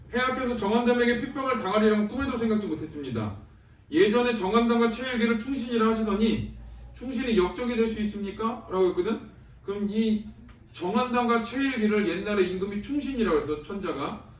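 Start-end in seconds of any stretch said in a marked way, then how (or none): 0.1-8.7 steady textbook reading voice, a touch formal; reading the text aloud. Acted. Teacher reads Chungryeol's words to the class, formal reading. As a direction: read the hero's plea like a passage from the book.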